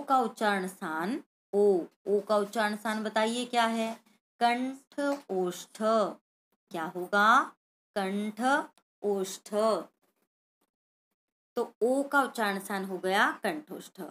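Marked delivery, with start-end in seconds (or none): 4.4-5.8 drawn out, each word set apart; slow syllable-by-syllable answer. Shown as a demonstration. Teacher answers her own question for children, slowly drawn out. 8.0-9.5 both words stretched and separated; slow syllable-by-syllable answer. Shown as a demonstration. Teacher repeats the answer for children, slowly drawn out.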